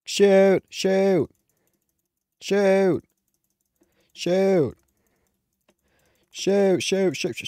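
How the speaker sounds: high, loud repeated yells; panicked shouting. Real, not acted. Shouting shoot while under fire in the game. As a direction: yell it over and over in panic.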